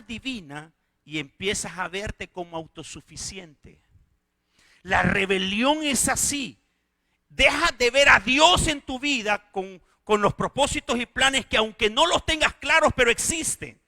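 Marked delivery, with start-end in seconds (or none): 4.8-13.8 loud, high, impassioned preaching; loud pulpit cry over the congregation; full-force preaching. Acted. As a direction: preach it with rising fire. Preaching build.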